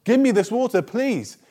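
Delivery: high, eager plea; acting out an excited woman begging; excited request. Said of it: "she says excitedly".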